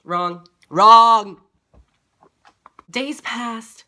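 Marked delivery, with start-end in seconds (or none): 0.7-1.4 one drawn-out, very loud buzzer-like word; loud blare. Real, not acted. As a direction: blare the correction at full volume. Second take, blared for emphasis.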